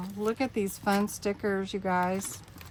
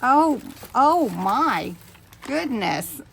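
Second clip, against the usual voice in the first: overwhelmed; 3 semitones higher, 6 dB louder, slower than usual. Real, not acted. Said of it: "I'm a little bit overwhelmed".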